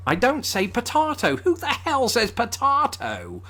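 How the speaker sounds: high-pitched comic outrage; indignant disbelief. Real, not acted. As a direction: protest it in a squeaky, incredulous voice. Indignant at the song lyric.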